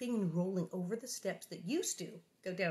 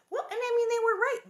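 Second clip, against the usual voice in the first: much higher and louder; excited agreement; 7 dB louder, faster than usual. Real, not acted. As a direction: burst out in eager agreement. Emphatic excited aside.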